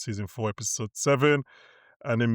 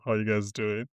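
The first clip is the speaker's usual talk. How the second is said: normal talking voice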